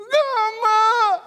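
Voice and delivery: very high, loud wailing cry; preacher crying out over the sick man; anguished cry. Acted. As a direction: cry out as if in pain. Crying out while praying.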